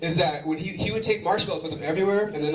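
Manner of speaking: ordinary talk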